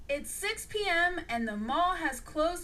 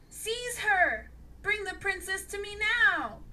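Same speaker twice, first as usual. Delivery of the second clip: bellowing order; 8 semitones higher, 4 dB louder than usual. A narrator's reading. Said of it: "bellowed the King Spider".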